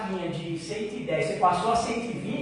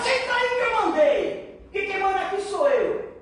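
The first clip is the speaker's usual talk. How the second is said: mock yelling leader; 9 semitones higher than usual. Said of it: "ficar gritando na tua, no teu ouvido".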